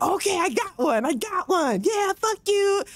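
high, gloating gamer voice, well above her own; mocking PvP gamer. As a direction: crow over the kill like a smug player. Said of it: "the P V P person's like".

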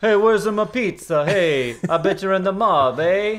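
high, loud hammed-up Italian; caricature Italian accent. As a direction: play a cartoon Italian at full tilt. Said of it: "as a racist Italian voice".

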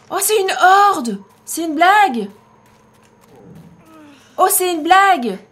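cri fort et aigu; crie paniquée. Real, not acted. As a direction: hurle d'incrédulité devant la horde. Une horde lui court après. (cri de panique)

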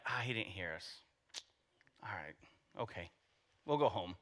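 soft, low, deflated shrug; a hammed-up defeated mumble; mock giving up. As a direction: sigh it out like giving up too easily. Mock resigned blind men giving up.

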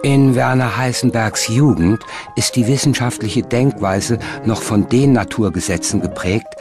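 ordinary delivery

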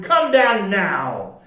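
high, scolding storybook captain; angry order. A narrator's reading. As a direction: order sternly, drawing out the first words. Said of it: "he ordered".

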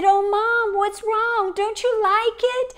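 put-on a small girl frightened for her mom; worried little girl. Daughter asking her tearful mother what is wrong, high child voice.

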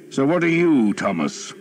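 breezy lordly question; earl asking. A narrator's reading. Earl addresses Thomas.

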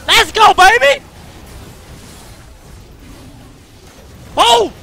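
triumphant shouts. Real, not acted. Gamer celebrating a double kill, shouting high and loud.

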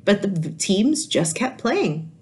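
put-on lively read-aloud voice for kids; animated children's story reading; storybook narration. A narrator's reading. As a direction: tell it like a picture book to a class. Teacher reads picture book aloud.

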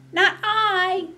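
high, loud piggy squeal. Acted. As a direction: squeal it like a little pig. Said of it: "squealed the pig".

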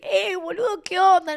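high, friendly holler in imitation; excited calling out. Friends greeting the DJ from the crowd, excited call. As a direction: hail a buddy from afar.

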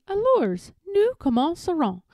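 high, singsong echo; mimicking the tutor. A narrator's reading. Eloise copying her French tutor, high and cheeky. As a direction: parrot the French right back. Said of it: "And I say".

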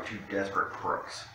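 usual speaking voice

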